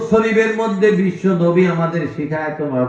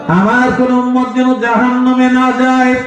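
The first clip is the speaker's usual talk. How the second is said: high, ringing plea at preaching pitch; impassioned retold plea; 10 semitones higher, 4 dB louder than usual. Preacher repeating the Prophet's prayer, high impassioned plea. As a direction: cry it out high to the crowd. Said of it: "সেজদায় পড়ে কাঁদবেন আর বলবেন".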